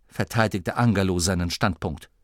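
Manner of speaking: ordinary delivery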